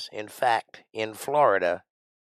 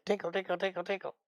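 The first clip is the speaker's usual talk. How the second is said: much higher and much softer tinkle, tinkle; magic sound effect; 8 semitones higher, 11 dB quieter, faster than usual. Shown as a demonstration. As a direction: chime it like a tiny magic bell. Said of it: "that little sound effect".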